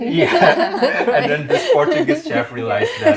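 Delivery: high, laughing hard through words. Real, not acted. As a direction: keep laughing while talking. Laughing at the accent joke.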